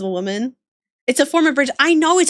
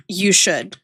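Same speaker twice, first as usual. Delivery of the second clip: ordinary talk, nothing marked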